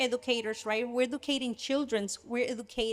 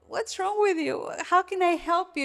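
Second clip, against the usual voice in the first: husband's worried question, pitched higher; caring husband; 6 semitones higher than usual. Retold or imitated. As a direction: play the husband gently asking what's wrong. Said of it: "And my husband is like".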